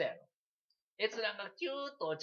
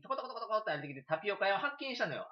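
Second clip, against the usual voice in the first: usual speaking voice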